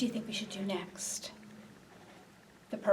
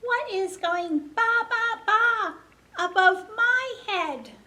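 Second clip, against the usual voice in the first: put-on high, loud little baby; 12 semitones higher, 5 dB louder than usual. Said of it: "And the baby says".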